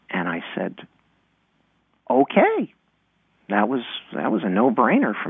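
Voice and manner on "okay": put-on high-pitched kid's okay; eager child. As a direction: agree like a small kid, voice jumping up. Child self agrees eagerly, retold.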